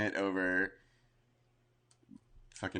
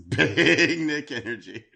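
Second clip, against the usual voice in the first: slow, laughing and louder; 6 semitones higher, 6 dB louder, slower than usual. Shown as a demonstration. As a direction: laugh through the catchphrase. Laughing repeat of sign.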